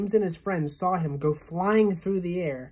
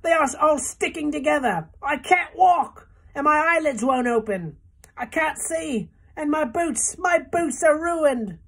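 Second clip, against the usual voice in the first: angry wailing; 11 semitones higher, 7 dB louder than usual. A narrator's reading. Centipede screams angrily at the paint, high and loud. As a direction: rage and despair over the ruined boots. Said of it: "The centipede screamed with anger".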